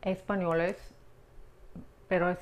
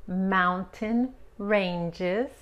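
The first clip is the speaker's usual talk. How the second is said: "Mountain ranges" drawn out very slowly; slow model; 3 dB louder, much slower than usual. Shown as a demonstration. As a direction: stretch "Mountain ranges" syllable by syllable. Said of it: "teacher models slowly". ("Mountain ranges" muy despacio)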